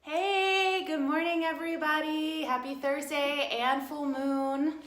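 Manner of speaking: high, loud sing-song welcome; bright greeting. Real, not acted. Greeting viewers at the start of a full moon livestream, sing-song excited. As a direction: stretch out a big, high hello.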